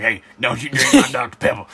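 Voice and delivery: guard the soda like the teacher; mock teacher warning. Teacher warning a student off his soda, mock warning.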